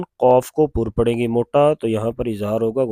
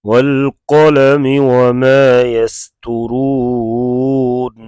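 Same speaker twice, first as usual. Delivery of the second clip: slow, louder drawn-out recitation; tajweed-style recitation; Quran recitation; 4 dB louder, much slower than usual. Acted. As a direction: chant it with long held vowels. Teacher recites the surah.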